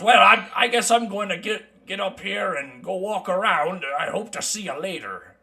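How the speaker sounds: lightly voiced mountain dwarf; easygoing dwarf traveler. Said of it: "He goes".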